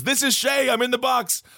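high, lilting call from inside a box; Shay in the coffin. Retold or imitated. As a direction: announce yourself as the comic corpse. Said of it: "And then he says".

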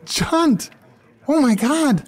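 high, startled cry; stunned, pitch leaping way up; shocked. Acted. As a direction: gasp it out at a surprise proposal. Reacts to surprise marriage proposal.

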